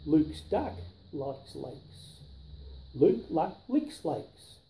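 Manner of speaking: plain reading voice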